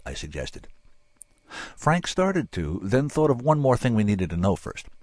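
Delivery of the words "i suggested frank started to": usual speaking voice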